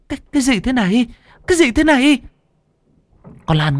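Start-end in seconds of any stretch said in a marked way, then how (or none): 0.1-1.1 high, stammering in shock; terrified gasp. Acted. Hằng seeing her friend possessed, terrified. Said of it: "thì kinh hãi". 1.5-2.2 high and louder, panicked repeat; terrified cry. Acted. Hằng staring at Lan in horror, panicked cry. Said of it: "thì kinh hãi".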